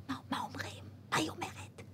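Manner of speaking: hushed, barely voiced questions; furtive whisper. Confused recruit asking the soldier beside her, whispering. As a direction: whisper it secretly to your neighbour.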